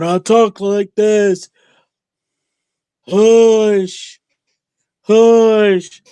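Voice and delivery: high, loud, slow put-on cartoon voice. Mocking a wrestler rival with a high cartoonish voice. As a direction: ham up a squeaky cartoon character, hushing. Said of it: "That sounds like a cartoon".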